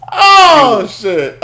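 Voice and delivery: loud, high drawn-out "oh"; loud outburst. Real, not acted. Podcast host blurts out mid-show, loud outburst. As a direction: burst out loudly, then catch yourself.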